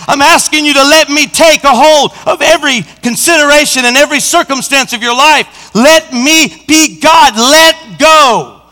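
emphatic pulpit appeal in God's words; urgent, commanding sermon voice; forceful preaching. Acted. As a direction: press each command home, building in force.

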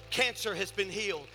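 high preaching voice building to loud shouts; fervent preaching. Real, not acted. Preacher testifying to the congregation about healing oil, fervent and building. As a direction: preach it with mounting force.

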